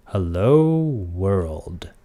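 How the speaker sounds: very slow, stretched-out "Hello world"; really slow. Shown as a demonstration. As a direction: draw out the vowels in HELLO and WORLD. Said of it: "Maybe even slower than that".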